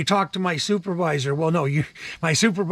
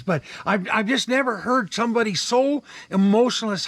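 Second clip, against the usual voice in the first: normal talking voice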